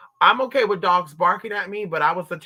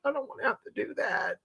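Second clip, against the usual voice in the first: high worried whine; 10 semitones higher than usual. Retold or imitated. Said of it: "So I'm like".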